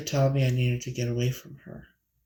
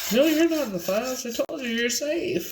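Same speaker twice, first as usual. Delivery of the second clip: friend's voice; 12 semitones higher than usual. Retold or imitated. Friend quoted reassuring on phone.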